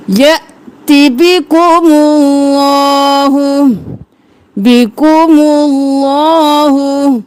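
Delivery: slow melodic tajweed chant; Quran recitation. Shown as a demonstration. Recites verse. (قرآن کی تلاوت، آہستہ اور سریلی)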